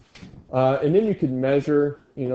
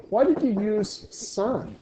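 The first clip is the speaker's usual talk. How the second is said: normal talking voice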